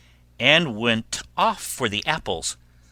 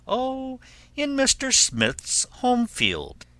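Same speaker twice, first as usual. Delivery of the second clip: high, airy storybook answer; coaxing wolf; 13 semitones higher than usual. Acted. Wolf tells pig of turnip field, high coaxing.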